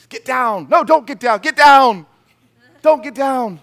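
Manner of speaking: high, loud, frantic mom shouting; panicked yelling. Retold or imitated. Mom panicking at her son on the roof. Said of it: "the mom starts freaking out instantly".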